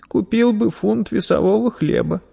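put-on high, small child's voice; meek young boy. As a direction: answer the merchant obediently. Boy answers meekly.

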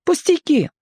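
put-on very high, childlike voice; boy brushing it off. A narrator's reading. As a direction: dismiss it as a young boy. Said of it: "объяснил он поскорее". (высоким голосом мальчика)

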